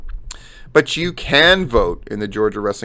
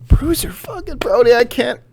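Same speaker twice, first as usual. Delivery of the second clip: squeaky mock voice; 12 semitones higher than usual. Host joking about the thumbnail meme, in squeaky falsetto. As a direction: put on a high squeal that trails down in volume.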